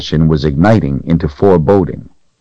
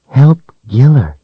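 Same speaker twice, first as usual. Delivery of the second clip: very high, tiny doll voice; sweet little toy giving advice; 8 semitones higher, 4 dB louder than usual. Acted. The doll repeats its answer.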